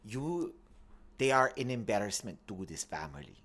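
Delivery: bad Italian accent. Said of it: "impersonation of [name]'s really bad Italian accent".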